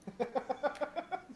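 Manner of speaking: loud, high giggling burst; real laugh. Real, not acted. Player laughs after planning retreat.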